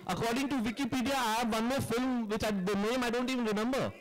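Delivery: agitated rebuttal. Real, not acted. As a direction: defend yourself, irritated and incredulous. Director rebuts Wikipedia claim.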